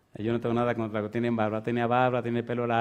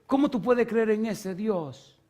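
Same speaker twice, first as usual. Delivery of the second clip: slow, much higher, indignant challenge; angry teacher; 9 semitones higher, slower than usual. Retold or imitated. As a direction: hurl the question like an outraged teacher. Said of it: "así bien enojado".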